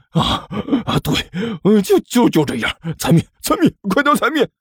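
high, gasping, broken words; pained urging. Acted. King urges underling stamping his paw, pained gasping. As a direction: gasp out orders through pain.

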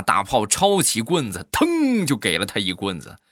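ordinary talk

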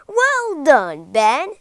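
loud hearty teacher's praise; teacher praising the pupil loudly. Acted. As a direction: boom out the congratulation.

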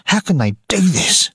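high, indignant outcry; angry. A narrator's reading. Maggie protesting Ned's hanging to her brother, angry and high. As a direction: cry it out in angry disbelief. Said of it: "said Maggie angrily".

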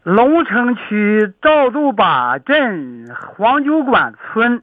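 spelling out each syllable slowly; slow, careful place name; slow and clear. Shown as a demonstration. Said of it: "记不住我这么长".

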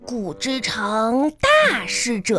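慢悠悠一字一顿地背古文; 像在课堂上背书那样拖着念; 背诵课文. Shown as a demonstration. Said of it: "课文上是这么说的". (孩子给爸爸背课文，慢慢拖着念)

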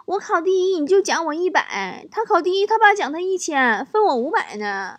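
put-on high-pitched child explaining himself; young son. Son excusing his second place to his father, high child voice. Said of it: "儿子说".

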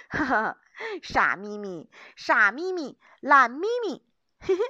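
loud, cackling singsong teasing; mock her through laughter; laughing taunt. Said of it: "松鼠笑着说着".

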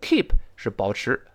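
usual speaking voice

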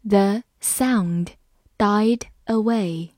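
slow, broken into short chunks; measured model reading for learners. Shown as a demonstration. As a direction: read slowly, pausing mid-sentence. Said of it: "我们慢读一遍".

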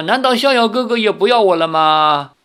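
a girl bursting into sobs, lightly acted; mock wailing. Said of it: "然后赵灵儿就大哭". (哭喊着问，略带表演)

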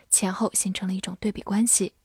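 usual speaking voice

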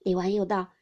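ordinary delivery

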